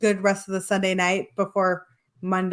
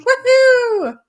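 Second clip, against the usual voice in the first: very high, loud whoop; hammed-up cheer; mock celebration; 13 semitones higher, 7 dB louder than usual. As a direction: whoop as if thrilled by a lie-in. Acts delight at sleeping till seven.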